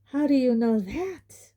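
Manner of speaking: high, astonished question. A narrator's reading. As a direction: ask in disbelief. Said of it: "he asked her, astonished".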